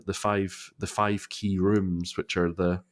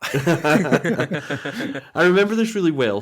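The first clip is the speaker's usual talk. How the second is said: laughing; 7 semitones higher, 6 dB louder, slower than usual. Real, not acted. Laughs recalling.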